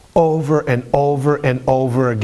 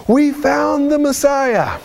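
high, drawn-out exultant cry; thrilled disciple breaking big news; excited announcement; 12 semitones higher, slower than usual. Acted. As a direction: proclaim it high and slow, overjoyed. Disciples announce finding Messiah to Simon.

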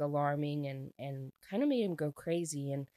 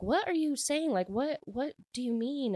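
confused surprise; 8 semitones higher than usual. Retold or imitated. Confused reaction quoted in retelling.

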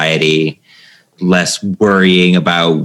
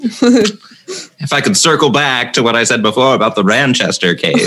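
higher, smug corporate interview voice; finance bro voice; 5 semitones higher than usual. Pretend. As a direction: play a slick job candidate. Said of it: "and you're like".

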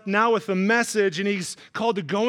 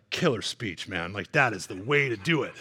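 laid-back angel voice; 10 semitones lower, 4 dB quieter than usual. Pretend. Angels voiced chatting to Jesus.

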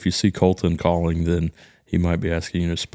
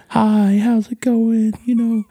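very high, louder mock customer-service voice; exaggerated imitation of a wife greeting a customer; wife's professional phone voice; 3 dB louder than usual. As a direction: gush in a high put-on phone voice. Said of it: "professional voice".

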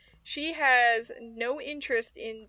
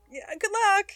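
higher, upbeat imitated well-wishing; positive; 8 semitones higher than usual. She wishes him luck ascending, positive imitation. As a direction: mimic her being breezily positive. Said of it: "She's so positive about it".

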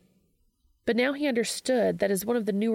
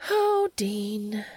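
sighing; 3 semitones higher than usual. Acted. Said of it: "sighed Charlie".

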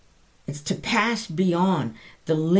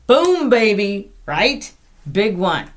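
loud, slow, higher whoop of delight; triumphant exclamation; 4 semitones higher, 8 dB louder than usual. Real, not acted. Preacher cheering the promise of God's peace, loud. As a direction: land it like a winning shot.

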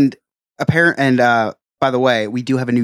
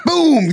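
gunshot sounds; 5 dB louder than usual. Pretend. Gunshot impression for duck race.

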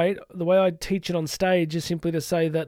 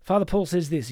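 normal talking voice

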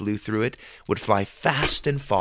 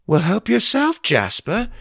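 much higher, louder dry sarcasm; sarcastic; 11 semitones higher, 4 dB louder than usual. A narrator's reading. Mother greets the barging messenger, sarcastically. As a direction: needle the rude guest. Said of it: "Ma said sarcastically".

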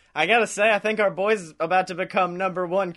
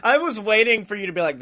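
usual speaking voice

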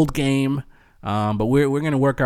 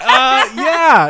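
awkward laughing reply; 10 semitones higher, 7 dB louder than usual. Retold or imitated. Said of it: "I'm like".

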